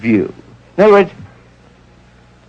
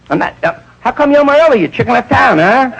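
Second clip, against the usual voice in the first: higher, quick mock needling; sarcastic wife; 9 semitones higher than usual. Wife taunts husband about his chicken, higher and sarcastic. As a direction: needle him fast and high.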